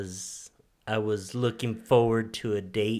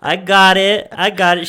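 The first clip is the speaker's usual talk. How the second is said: confident husband; 6 semitones higher, 12 dB louder than usual. Retold or imitated. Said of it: "I'm like".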